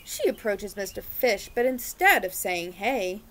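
lively picture-book read-aloud; reading to children. A narrator's reading. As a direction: read it like storytime for little ones.

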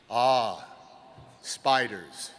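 knowing sage murmur; lightly voiced old sage; wise old sage. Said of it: "said the wise man".

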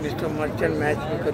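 normal talking voice

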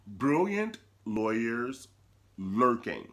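slow, grand announcer title; dramatic title read. Acted.

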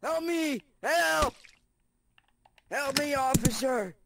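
high, urgent mock cries for help; calling for help. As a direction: cry out to the officer for help.

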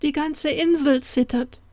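high, alarmed exclamation; storybook terror, pitched well up; frightened cry. Acted. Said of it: "vor Schrecken fast zu Boden sank".

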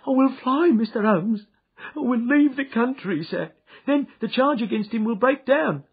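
Ryder high and loud, desperate; pleading Ryder. Acted. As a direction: plead to be let go.

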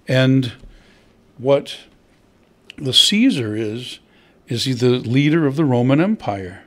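ordinary talk, nothing marked

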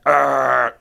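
loud gruff growl imitation; busey growl. Imitating Gary Busey's wild growl, loud. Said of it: "he's complete, like, that".

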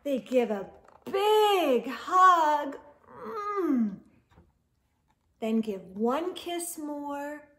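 lively storybook read-aloud; expressive picture-book narration; reading to children. Acted. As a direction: read it to kids at bedtime, animated.

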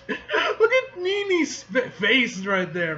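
slow words through real laughter; laughing. Real, not acted.

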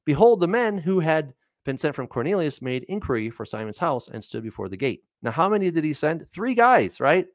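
normal talking voice

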